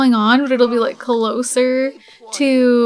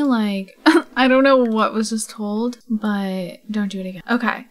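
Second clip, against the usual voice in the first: ordinary delivery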